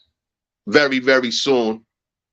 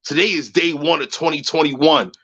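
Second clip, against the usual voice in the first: normal talking voice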